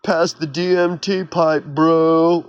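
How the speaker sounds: a slow, lazy party-bro drawl; lightly mock the careless partier; hammed-up stoner bro. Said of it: "It's just like".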